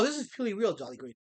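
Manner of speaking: ordinary delivery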